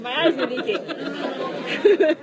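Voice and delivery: slow, laughing through tears; teary laugh. Real, not acted. Joking to coworkers about her tears, laughing. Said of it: "I'm leaking".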